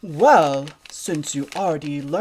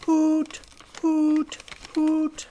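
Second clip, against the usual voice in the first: high hoots one by one; owl hooting; 11 semitones higher, much slower than usual. Shown as a demonstration. Little pig copying the owl's hoots. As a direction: copy the owl's hoot. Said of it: "Like this".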